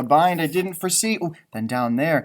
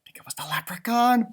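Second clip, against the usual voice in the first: whisper; 6 semitones higher than usual. Acted. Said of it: "unvoiced whisper".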